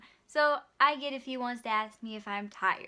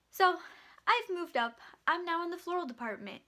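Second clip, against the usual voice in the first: ordinary talk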